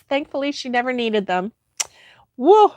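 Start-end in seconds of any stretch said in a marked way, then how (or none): 2.4-2.7 high, loud whoop; excited cheer. Real, not acted. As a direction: cheer them on with a big whoop. Cheering chat members' crocheting.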